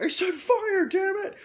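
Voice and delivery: high, loud angry yell; furious that nobody obeys; irritated shout. Retold or imitated. Sergeant angry his squad won't fire, high furious shout. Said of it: "he starts to get irritated".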